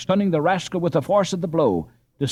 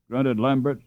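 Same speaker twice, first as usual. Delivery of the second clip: ordinary delivery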